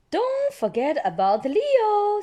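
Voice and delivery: put-on high, loud little girl; high child voice reminding mummy; Peppa's voice. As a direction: remind them eagerly. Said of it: "says Peppa".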